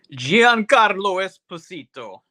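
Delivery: Giancarlo Esposito rolled out slow and high in an Italian accent. Shown as a demonstration. Telling a friend about the movie's cast, in an Italian accent. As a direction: savor Giancarlo Esposito like an Italian would, slowly. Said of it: "I'm gonna say it with that Italian accent".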